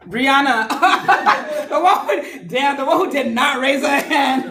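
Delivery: high, amused outburst through laughter; laughing. Real, not acted. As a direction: crack up while calling out the unlucky pick. Teacher cracks up at the pick.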